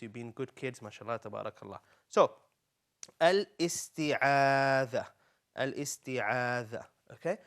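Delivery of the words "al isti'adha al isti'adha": "al-isti'adha" very slow, with long held vowels, twice; slow teaching pronunciation. Shown as a demonstration. Teacher helping pupils learn the word, "al-isti'adha" slowly drawn out. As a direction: stretch each syllable of "al-isti'adha" for repeating. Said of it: "let's learn it together".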